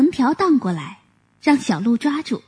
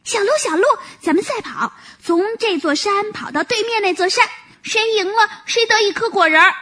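high, happy rabbit voice, quickening at the end; cheerful calling; 8 semitones higher than usual. Acted. Rabbit happily inviting the deer to race. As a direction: call the deer eagerly to a race. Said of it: "快活地叫着".

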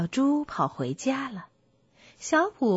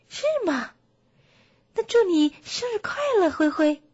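high, eager bear voice; delighted bear; 8 semitones higher, 4 dB louder than usual. Acted. Bear wishes the donkey happy birthday, delighted. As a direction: give an excited birthday wish, pitched up.

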